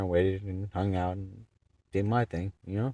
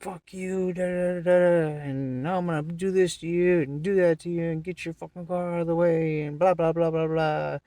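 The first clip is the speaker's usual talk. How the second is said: high, louder mocking voice of the ranting man; imitating the angry driver, high and mocking; mocking the angry guy; 11 semitones higher, 4 dB louder than usual. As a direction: lampoon his threats in a high voice. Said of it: "He's like".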